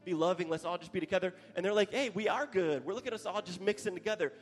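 preaching at full force. Acted.

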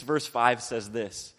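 normal talking voice

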